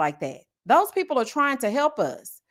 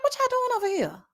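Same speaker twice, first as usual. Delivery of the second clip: mock surprise; 11 semitones higher, 5 dB quieter than usual. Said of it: "I'm like".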